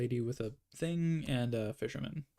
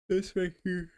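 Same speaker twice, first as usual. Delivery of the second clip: yawning; 8 semitones higher, 6 dB louder than usual. Real, not acted. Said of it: "Sorry, I'm yawning".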